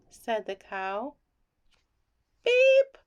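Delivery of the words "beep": high, loud beep; cheerful toy-truck beep; truck horn. Acted. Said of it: "said Blue".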